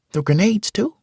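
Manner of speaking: put-on high boy's voice, rising question; excited boy's question. Malik asking about grenades, high and rising. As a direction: ask it wide-eyed like a kid. Malik asks, Mitch repeats.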